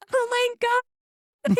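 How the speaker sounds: very high, slow mock moan of Oh my God; hammed-up ecstasy. Telling friends how she faked it, very high hammed-up moan. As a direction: stretch it out high like a staged climax. Mocking her own fake climax.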